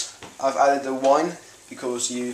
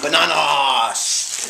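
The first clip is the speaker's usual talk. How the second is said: dramatic reveal; 4 dB louder, much slower than usual. Pretend. Mock grand reveal of bananas.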